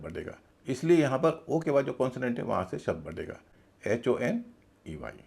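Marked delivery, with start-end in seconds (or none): none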